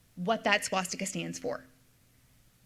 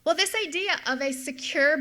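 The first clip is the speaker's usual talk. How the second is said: usual speaking voice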